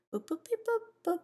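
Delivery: high whispered beep boops; playful whispered robot boops. Real, not acted. Playful noises while setting music.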